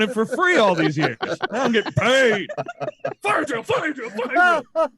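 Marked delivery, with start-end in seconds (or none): 3.2-4.9 high gleeful fire drill call. Pretend. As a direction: shout it out like a prankster instructor.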